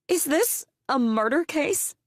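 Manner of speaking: slow, high, loud wail. Acted. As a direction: wail the question in anguish, slowly. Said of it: "wailed another mom".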